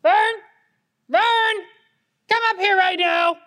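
shouting from afar to come up; yelling across the room. Acted. Calling brother upstairs.

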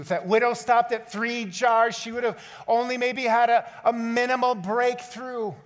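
high, loud, driving sermon voice; rising fervent pulpit call; full-force preaching. Acted. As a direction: preach it hard to the whole room. Preaching builds up.

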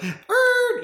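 loud, very high "errr" sound effect; imitated buzzer noise. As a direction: mimic a rejection buzzer. Imitated noise ending the mock refusal.

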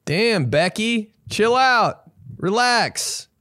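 high, slow exasperated plea; calling out to Becky, half-pleading with her to calm down; mock exasperation. Mocking Becky the loud coworker.

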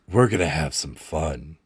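low, menacing promise; sly villain. Acted. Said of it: "Sparks says".

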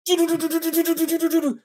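high, rapid stuttering gun noise; high-pitched automatic gunfire sound; imitated machine-gun fire. Imitates Cross's gun opening up.

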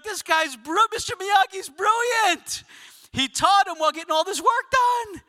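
high-pitched, hammed-up excited shouting; thrilled admiration. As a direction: gush loudly as an amazed fan. Acting viewer thrilled by Miyagi's cleverness.

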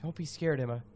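ordinary delivery

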